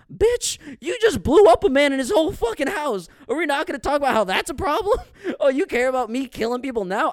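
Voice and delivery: real outraged rant at the movie's dad; incredulous rant. Real, not acted. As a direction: yell at the screen in disbelief. Ranting at character on screen.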